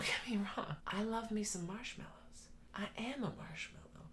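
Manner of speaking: a whispered confession. Real, not acted. Vlogger admits she is soft herself, whispered aside.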